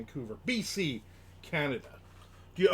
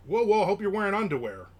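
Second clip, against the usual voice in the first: higher, louder joking whoa; mock alarm; 7 semitones higher, 8 dB louder than usual. Real, not acted. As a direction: fake shock at a pun. Joking reaction to dropping shorts.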